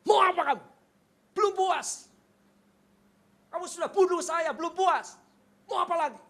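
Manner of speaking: high, loud furious yelling at the judge; shouting. Retold or imitated. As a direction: scream at him to get out. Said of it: "Saya teriak apa?".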